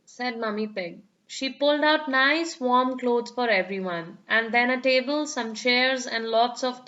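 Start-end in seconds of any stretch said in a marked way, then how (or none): none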